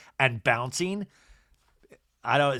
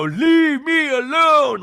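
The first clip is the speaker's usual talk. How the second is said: very high, loud, drawn-out mock wail; hammed-up shriek, stretching every word; over-the-top outburst; 12 semitones higher, 9 dB louder, much slower than usual. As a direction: overblown imitation of a dramatic demand. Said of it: "But she doesn't go".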